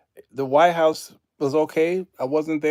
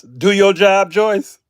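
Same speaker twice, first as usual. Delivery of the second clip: loud, raised scolding; sudden louder, higher order; calling out; 6 semitones higher, 11 dB louder, slower than usual. Real, not acted. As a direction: holler it at a slacker. Playfully scolding Joyce in chat.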